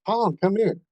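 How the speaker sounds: mock yell to fetch someone over; retold yell. Teammate calling Tom over to the fire, mild high-pitched yell. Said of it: "Dusty Roof yells at me".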